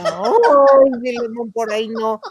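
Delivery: high moaning protest; hammed-up breathy plea; mock moaning woman. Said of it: "decía la otra". (mujer gimiendo en broma, voz aguda)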